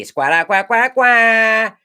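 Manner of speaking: high, drawn-out comic quacking; mock squawking. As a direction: squawk like a sad-trombone punchline. Mocking noise after losing the part.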